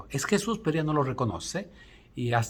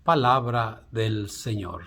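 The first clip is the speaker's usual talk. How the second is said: liturgical proclamation; 2 semitones lower, much slower than usual. Acted. Liturgical acclamation after the reading.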